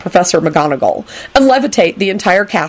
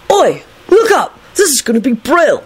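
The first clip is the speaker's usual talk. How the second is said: put-on pitched-up boyish excitement; happy announcement; 9 semitones higher than usual. A narrator's reading. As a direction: call everyone to watch with glee. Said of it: "announced happily".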